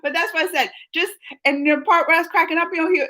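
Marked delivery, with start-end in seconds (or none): none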